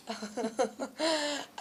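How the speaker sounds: soft laugh, slightly bashful; light real giggle; amused laugh. Real, not acted. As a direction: laugh off a question about yourself. Amused by question about herself.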